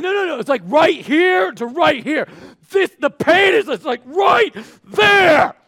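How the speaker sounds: loud, high, strained bragging; hammed-up agony, stretching each spot out slowly; overexcited gym guy. Gym guy showing a listener where it hurts, overexcited.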